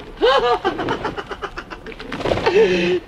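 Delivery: high delighted giggle; gleeful laugh. Acted. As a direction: laugh with relief. Landed safely.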